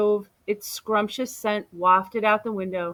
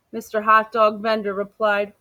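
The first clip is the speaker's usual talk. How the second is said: plain reading voice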